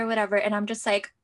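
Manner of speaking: ordinary talk, nothing marked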